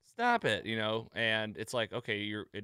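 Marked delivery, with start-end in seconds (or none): 0.0-0.7 high-pitched mimicry of the wife; imitated plea. Quoting the wife looking at her hostess, in a high imitating voice. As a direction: pitch it way up, echoing her protest. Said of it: "she was saying, like".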